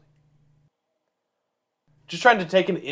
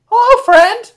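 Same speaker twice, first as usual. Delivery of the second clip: high greeting; 10 dB louder than usual. Pretend.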